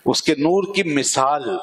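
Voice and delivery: ordinary talk, nothing marked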